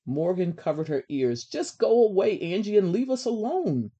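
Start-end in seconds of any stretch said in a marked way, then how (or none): 1.4-3.9 high, loud brush-off; exasperated. A narrator's reading. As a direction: send the pest away, voice raised. Covers ears, sends pest away.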